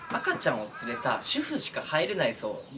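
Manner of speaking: normal talking voice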